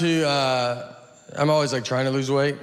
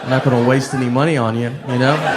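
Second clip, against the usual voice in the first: laughing mock scolding; 5 dB louder than usual. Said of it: "be like".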